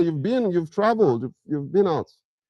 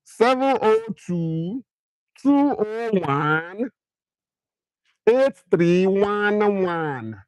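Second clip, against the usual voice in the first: slow Nigerian-accented digits, high and drawn out; 4 semitones higher, much slower than usual. Shown as a demonstration. Streamer repeats his number for chat in a Nigerian accent. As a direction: read the number like a Lagos guy. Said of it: "make a repeat am for Naija accent".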